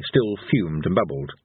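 plain reading voice

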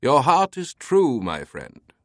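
slow, high, theatrical British voice; ceremonious British gatekeeper; grand British pronouncement. A narrator's reading. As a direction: grant passage grandly in a British accent. Teabing grants passage theatrically.

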